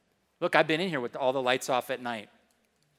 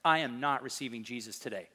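retold low, quieter dig-in-your-heels refusal; mock-obstinate, firm and understated; 5 semitones lower, 6 dB quieter than usual. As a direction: imitate a stubborn refusal, kept low and controlled. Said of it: "I was so obstinate".

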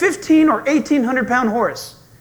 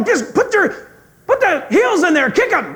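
higher, eager urging; coaching guy; 8 semitones higher than usual. Retold or imitated. Riding guide urging the scared rider to kick the horse. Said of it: "then the guy goes".

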